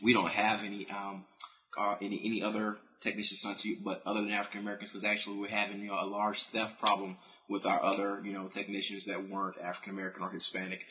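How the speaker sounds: low, soft, polite customer-service voice; smooth it down like a polite phone agent; preppy phone voice. Retold or imitated. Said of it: "my preppy voice on when I worked".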